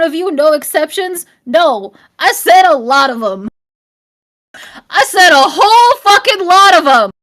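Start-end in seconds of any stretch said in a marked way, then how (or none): 1.5-7.1 loud, heated rant at chat; angry yelling. Real, not acted. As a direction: yell it out, fed up. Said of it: "I'm shouting, I'm yelling so much".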